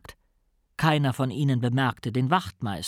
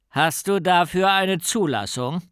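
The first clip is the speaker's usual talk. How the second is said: higher, pointed official question; officious challenge; 5 semitones higher, 4 dB louder than usual. A narrator's reading. Policeman questions boy about his unicycle, officious. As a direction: demand papers like a keen policeman.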